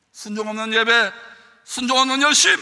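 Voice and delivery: loud, high preaching; preaching shout. Acted. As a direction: hammer each phrase. Preacher peaks in force.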